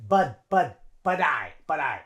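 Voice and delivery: "but" said like "bud". Shown as a demonstration. Teacher shows T sounding like D, linked. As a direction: link "but I" as "bud-eye". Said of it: "is like a D sound a little bit".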